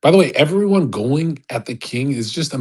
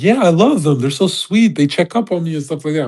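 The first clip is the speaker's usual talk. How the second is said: mock-gushing, higher-pitched quote of a sweet streamer; gushing streamer imitation; 5 semitones higher than usual. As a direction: imitate someone adoring their fans. Voicing streamers fond of their chat.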